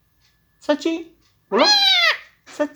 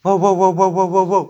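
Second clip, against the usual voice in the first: dog bark; 2 semitones lower, 4 dB louder than usual. Shown as a demonstration. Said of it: "अभी कुत्ता का आवाज निकालो।".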